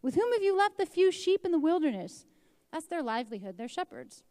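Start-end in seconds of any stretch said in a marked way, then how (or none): 0.0-2.2 higher, louder, accusing demand; angry older brother. Acted. Eliab rebuking David for abandoning the flock, angry and loud. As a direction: scold him for leaving the sheep. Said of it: "His anger burned against David".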